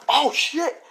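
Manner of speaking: high-pitched startled exclamation; acted sudden alarm, way up high; shocked panic. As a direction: yelp it like you just saw the mess. Voicing his shock on waking.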